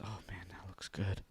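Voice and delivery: low, hushed whisper; covetous lunch lady. Acted. Lunch lady eyeing the kid's leftovers, whispered longing. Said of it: "the lunch lady says".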